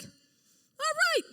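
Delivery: high-pitched giving in; grudging agreement. Retold or imitated. Plow driver gives in for a hundred bucks, high mock concession.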